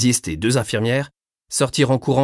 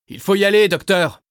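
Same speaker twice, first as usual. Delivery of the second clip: raised voice over the rotor noise; shouting; 11 semitones higher, 4 dB louder than usual. A narrator's reading. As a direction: call out loudly to the doctor. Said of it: "lui cria le pilote".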